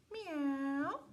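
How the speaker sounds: kitty meow. Acted. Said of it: "said the kitty".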